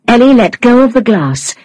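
ordinary delivery

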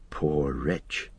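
low, soft, mildly remorseful; understated pity, voice dropped; half remorseful. A narrator's reading. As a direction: say it quietly with a touch of remorse. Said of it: "he said half remorselessly".

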